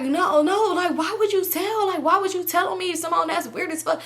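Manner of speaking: high, whiny mock protest; upset friend mimicry. Friend asks why she told, high mock whine.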